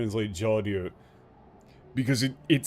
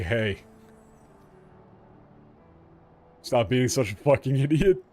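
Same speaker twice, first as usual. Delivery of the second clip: dragon voice; 3 semitones higher than usual. Pretend. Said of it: "why is he doing the dragon voice?".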